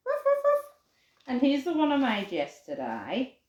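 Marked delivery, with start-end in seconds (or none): 0.1-0.6 high puppy woofs; little dog yapping; playful barks. Pretend. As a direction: bark brightly for the children. Playing the craft dog.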